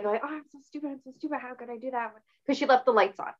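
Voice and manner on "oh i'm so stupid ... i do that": softer and higher, a mock tearful wail; imitated crying mom. Mom berating herself after the car dies, soft mock crying. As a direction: play the upset mom, lightly tearful. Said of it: "from the car crying".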